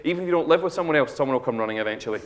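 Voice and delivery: ordinary talk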